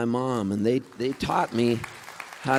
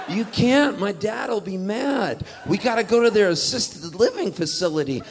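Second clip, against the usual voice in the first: hammed-up high objection; mock alarmed protest; 7 semitones higher than usual. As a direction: protest like a worried kid, pitched up. Protesting to the kid about dad.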